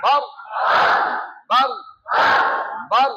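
বার with a heavy, thickened final r; thick r. Shown as a demonstration. Teacher drills the class on the r, thick-r বার. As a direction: make the r in বার thick and full. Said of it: "রটাকে মোটা করতে হবে".

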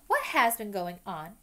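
loud, slow demand; bossy girl scolding the boys. Acted. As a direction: stride in and demand answers. Hermione walks in, demanding.

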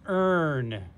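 "earn" slowly drawn out; teacher's slow model of "earn"; word demonstration. Shown as a demonstration. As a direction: hold the er in "earn" before the n. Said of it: "This says earn".

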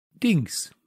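high, short exclamation; called out. A narrator's reading. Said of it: "rief der Mann". (hoch herausgerufen)

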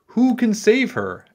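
high-pitched, pleading king; king asking for help. A narrator's reading. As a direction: ask it high, as a storybook king. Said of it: "asked the king".

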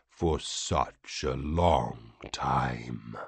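low, slow whisper; creature whisper. Acted. Vati whispering to the pinned prisoner, slow and low. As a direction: breathe it out with cold patience. Alien lord gloating over captive.

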